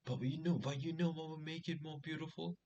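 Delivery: high, sly teasing lead-in; cheeky setup. Retold or imitated. Delivering his dirty joke to the girls, sly and high.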